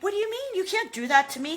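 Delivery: high indignant whine; whiny Joffrey. Retold or imitated. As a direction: squeal like a spoiled brat. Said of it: "He's like".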